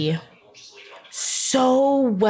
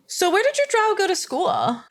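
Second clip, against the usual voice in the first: mock question, pitched way up; high mocking question; 12 semitones higher than usual. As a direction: ape a clueless question with a much higher voice.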